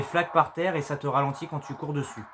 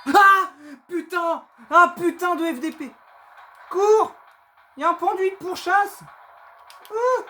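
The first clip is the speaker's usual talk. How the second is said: terrified high screaming; panicking gamer; 13 semitones higher, 7 dB louder than usual. Real, not acted. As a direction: scream while fleeing the monster. Monster chase, screaming.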